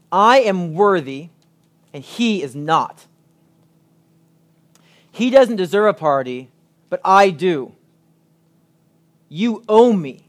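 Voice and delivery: self-righteous, slow, insistent claim; entitled demand. Acted. Older brother demanding his due, entitled and insistent. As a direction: press the claim of being owed. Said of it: "what the older brother is really saying is this".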